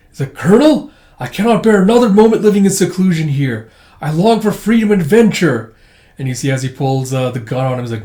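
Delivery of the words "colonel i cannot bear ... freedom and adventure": high, loud melodramatic appeal; acted desperate outpouring; impassioned plea. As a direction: plead for your freedom with full heart. Robot begs to escape seclusion.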